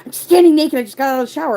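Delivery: high, loud imitation of a snappy mom; mock exasperated yell. Mom answering daughter's call fresh from the shower, mock exasperated yell.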